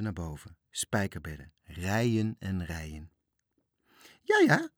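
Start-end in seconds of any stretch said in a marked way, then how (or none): 4.2-4.7 put-on high, loud cackling old dame; cackling lady. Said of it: "kakelde de dame". (kakelend hoog)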